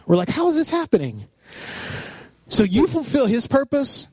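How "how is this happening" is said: high-pitched amazed disbelief; freaking out. Retold or imitated. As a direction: retell it as giddy bewilderment. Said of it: "we're just, like, freaking out".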